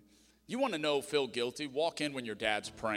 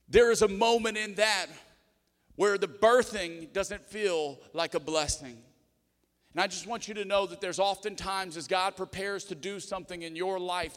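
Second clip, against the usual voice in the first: raised, higher-pitched preacher's voice; impassioned preaching; 8 semitones higher, 8 dB louder than usual. Real, not acted. Pastor urging the church that blessing comes through pain, impassioned preaching. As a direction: preach it out with force to the congregation.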